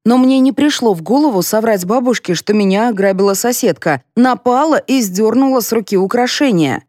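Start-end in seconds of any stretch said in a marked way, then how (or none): none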